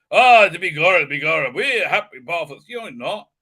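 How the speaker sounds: hammed-up stage Irish accent; cod Irish accent. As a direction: do an embarrassingly bad Irish accent. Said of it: "an Irish accent that embarrassing, that cod".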